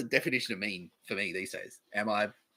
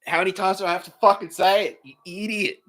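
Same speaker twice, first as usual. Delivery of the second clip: mock-aggressive, raised voice; mock aggressive rant; 8 semitones higher, 4 dB louder than usual. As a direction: bark it like an annoyed troll-fighter. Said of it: "I was intentionally aggressive".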